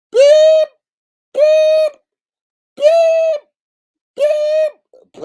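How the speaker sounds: very high, loud mock reversing beeps; truck backup beeps. Teasing Brianna with piercing truck backup beeps. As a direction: beep like a reversing dump truck.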